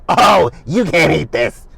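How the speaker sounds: loud, high, drawn-out mock rudeness; Erica. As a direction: blurt it like a rude, exaggerated scolding. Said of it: "Erica's old rude ass".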